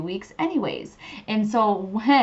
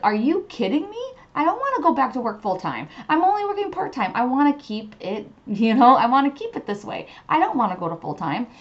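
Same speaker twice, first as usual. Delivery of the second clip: mock disbelief, pitched much higher; exasperated protest; 5 semitones higher, 3 dB louder than usual. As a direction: whine in disbelief, climbing up high. Man protests to Spirit, retold.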